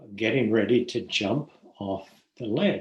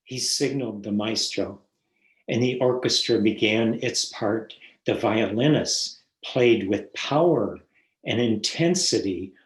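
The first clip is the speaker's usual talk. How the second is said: poem reading; 4 dB louder than usual. Acted. Reads a poem aloud.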